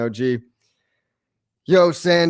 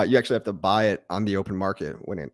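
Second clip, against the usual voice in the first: normal talking voice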